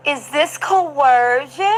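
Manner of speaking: mocking, very slow interrogation; in-her-face taunt. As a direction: stretch it slowly into a confrontational question. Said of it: "he got in her face and was like".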